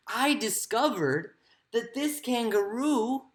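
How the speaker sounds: ordinary talk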